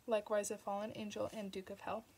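ordinary delivery

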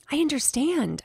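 high-pitched squeaky reply; small, high creature voice; squeaking small pet. A narrator's reading. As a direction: squeak it out like a little hamster. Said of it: "I squeaked".

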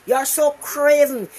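ordinary delivery

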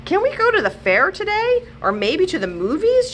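put-on a young child asking grandpa a favor; child's voice. A narrator's reading. Grandchild asks grandpa over breakfast, eager kid voice.

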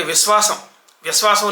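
విశ్వాసం as vis-waasam, hissed s for శ; faulty విశ్వాసం without the sh sound; mispronounced plain s. Shown as a demonstration. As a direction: wrongly flatten శ to s in విశ్వాసం. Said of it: "కర్ణ కఠోరంగా వినపడుతుంటుంది".

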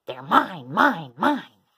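loud, punchy claim of ownership; possessive shout. A narrator's reading. Dinosaur grabbing scones and tarts, shouting greedily. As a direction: hit each word hard, greedily.

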